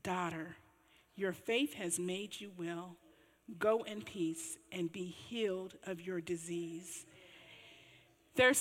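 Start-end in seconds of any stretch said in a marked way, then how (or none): 0.0-7.0 lower, softer, slow blessing; tender Jesus. Retold or imitated. Jesus blessing the healed woman, soft and slow. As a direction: gently send her off in peace. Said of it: "And he said to her".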